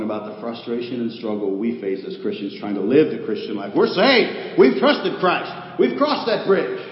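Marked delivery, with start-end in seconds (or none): 3.8-6.8 higher and louder, drawn out slowly; emphatic preaching. Acted. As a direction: declare each step triumphantly. Emphatic sermon declarations.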